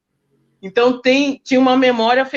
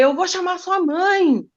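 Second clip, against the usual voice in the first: nervous threat; 8 semitones higher, slower than usual. Retold or imitated. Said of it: "fiquei muito nervosa com ele".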